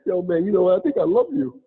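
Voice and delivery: high-pitched, playfully choked-up confession; mock tearful. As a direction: act as if almost moved to tears. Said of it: "I almost had a tear".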